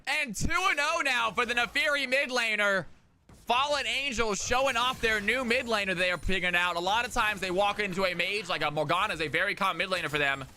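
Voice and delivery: excited caster calling a kill; live play-by-play. Acted. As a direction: build the call up to the kill. Esports play-by-play casting.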